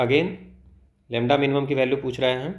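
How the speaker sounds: ordinary talk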